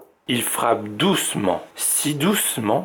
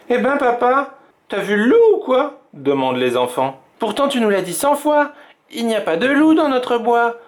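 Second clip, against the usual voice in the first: enfants taquins; 11 semitones higher than usual. A narrator's reading. Children tease papa.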